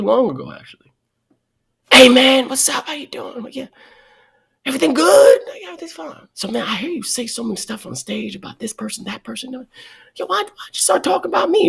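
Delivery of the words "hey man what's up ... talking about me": lightly voiced high fake-friendly greeting; mock friendly caller. Snubbing mentor calls back, hammed-up fake friendliness. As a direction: play it sugary and innocent. Imitating the person who snubbed him.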